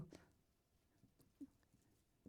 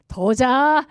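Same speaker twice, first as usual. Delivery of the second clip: curt husband; 3 semitones higher, 11 dB louder, much slower than usual. Retold or imitated. Husband's curt reply, acted.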